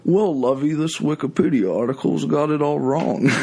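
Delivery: hammed-up upper-class Long Island accent; Thurston Howell. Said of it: "like Thurston Howell the third".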